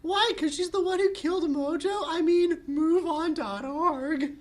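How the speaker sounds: put-on very high, bright girly voice; squeaky cheerful sister; Bubbles voice.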